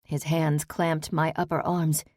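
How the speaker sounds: usual speaking voice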